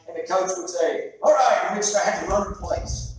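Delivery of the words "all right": coach's call. Retold or imitated. Coach calls start of drill.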